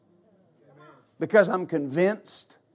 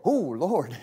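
low, soft, dismayed groan; a deflated gasp at the price; sticker shock groan; 6 semitones lower, 5 dB quieter than usual. Retold or imitated. Car buyer sees the price tag, low soft dismayed groan. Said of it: "And you go".

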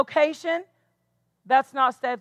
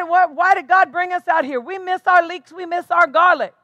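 higher, louder complaining grumble; a crowd griping to God, higher and louder; whining Israelites; 4 semitones higher, 4 dB louder than usual. Retold or imitated. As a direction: whine like starving people begging to go back.

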